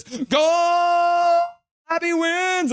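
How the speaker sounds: high shouted cheer, go and win; cheering chat message. Retold or imitated. Reading a fan's cheer to the stream, shouted high. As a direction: belt it out like a fan. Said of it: "And Jay says".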